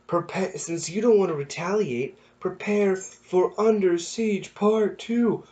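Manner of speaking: high, slow, showy promo shout; hyped wrestler promo. Retold or imitated. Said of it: "you hear the New Day go".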